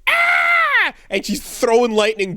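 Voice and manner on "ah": sudden piercing shriek, much higher and louder; mock scream. Azula losing it at her brother, piercing mock scream. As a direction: let out a shrill cartoonish scream. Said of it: "Azula's like".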